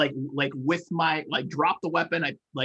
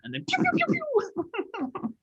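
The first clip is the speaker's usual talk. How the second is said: pew pew gun sounds. Acted. Imitating gunfire.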